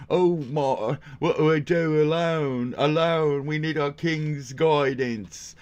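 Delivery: high, loud whiny British voice; mock lost subjects begging for a king; mocking helpless Brits. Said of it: "It's a bunch of fucking, like".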